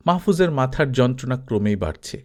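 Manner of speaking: ordinary delivery, nothing marked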